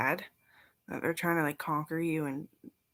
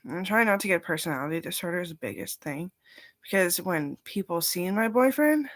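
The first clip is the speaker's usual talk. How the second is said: ordinary talk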